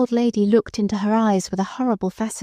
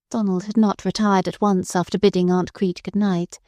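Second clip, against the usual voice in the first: ordinary delivery, nothing marked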